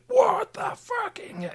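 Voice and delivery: high, drawn-out, wispy disbelief; reliving a jaw-dropped reaction; stunned exclamation. Retold or imitated. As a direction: gasp it out slowly, high and incredulous. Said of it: "just going".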